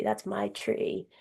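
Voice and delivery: slow, hushed whisper; whispered grief. Retold or imitated. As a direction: whisper it slowly, hurt. Grief over the lost tree.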